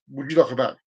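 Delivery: low, polite cashier's offer; easy low-pitched shop assistant question; considered offer. Shown as a demonstration. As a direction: offer it calmly after waiting. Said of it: "then I would say".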